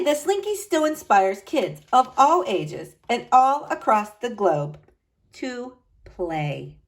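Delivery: plain reading voice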